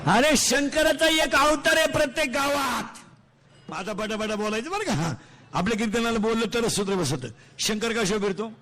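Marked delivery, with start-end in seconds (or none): 0.1-3.0 calling out. Real, not acted. Preacher to audience on Shankar in every village, calling out. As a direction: proclaim it high to the whole crowd.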